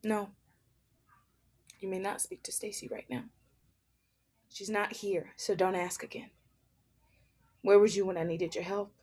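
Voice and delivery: guard her coldly; cold protector alter. Acted.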